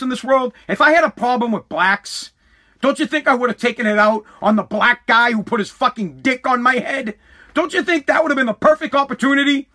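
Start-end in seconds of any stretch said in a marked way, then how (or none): none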